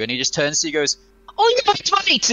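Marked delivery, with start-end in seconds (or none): 1.4-2.3 high, shrill mock Welsh accent. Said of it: "in his, like, sh-, fucking Welsh accent".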